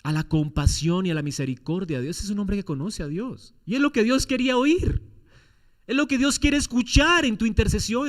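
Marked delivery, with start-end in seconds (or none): 3.7-8.1 higher and louder closing appeal; rising exhortation. Acted. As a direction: urge the brothers to intercede.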